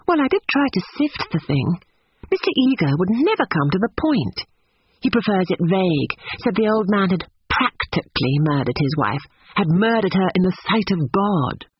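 put-on high, earnest young woman; indignant Lucy.

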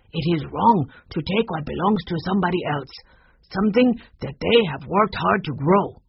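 measured, emphatic character voice; earnest, deliberate admonishment; principled gardener. Acted. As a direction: lay down the moral firmly. Voices Mister Yi in the story.

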